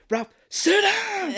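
very high, loud drawn-out shout; hammed-up explosive command; mock furious yell. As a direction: bellow the order like an enraged movie husband. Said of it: "Just yells at this guy".

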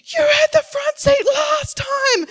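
put-on high, loud, slow childish yell; kids screaming. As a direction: squeal it like a squabbling child. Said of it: "run outside screaming".

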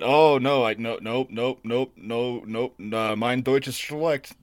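slow playing dumb in broken German; mock German. Tourist dodging a yelling local, slow mock German. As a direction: fumble slowly through fake German. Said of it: "I'm starting to get yelled at".

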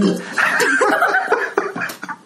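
high breathy cackling laugh; wheezy old man laugh. Acted. GM laughs in character.

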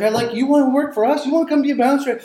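mock eager offer. Bouncer offers him a job.

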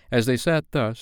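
ordinary delivery, nothing marked

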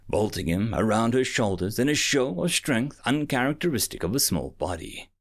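usual speaking voice

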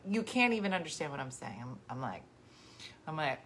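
ordinary talk

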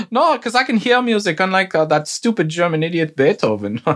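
put-on high, smug child prodigy voice; boastful Mozart voice. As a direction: brag like a spoiled genius.

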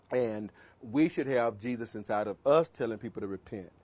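very low, soft, subdued delivery; hushed, low demonstration of dull preaching; unexcited preaching. Shown as a demonstration. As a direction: preach it with all the excitement drained out. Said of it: "I'll be talking like this".

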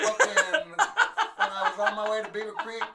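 put-on laughing Rez accent voice; Res accent. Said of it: "harshest fucking Res accent".

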